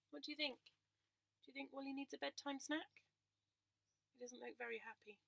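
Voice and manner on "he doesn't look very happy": usual speaking voice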